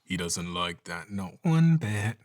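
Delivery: slow, even siren. Shown as a demonstration. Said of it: "a little more even".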